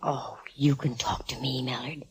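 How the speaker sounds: hushed conspiratorial whisper, almost no voice; mock whisper. [name] teasing Mallard with a mock confidential whisper. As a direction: lean in and whisper it like a secret tip.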